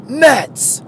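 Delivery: mock yell. Passerby heckling a Yankee fan, mock yell. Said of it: "he kinda yells".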